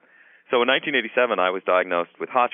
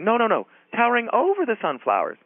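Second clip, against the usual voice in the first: much higher, insistent neighbor voice; curious neighbor. Retold or imitated. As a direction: pitch way up, pressing the point. Neighbor's voice in retold exchange.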